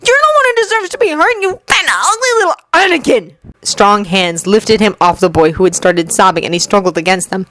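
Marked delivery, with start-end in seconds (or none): 0.1-3.4 high, fast raging yell; furious boy. Acted. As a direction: spit it out in fury. Anakin raging at the bully.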